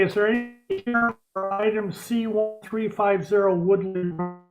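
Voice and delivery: normal talking voice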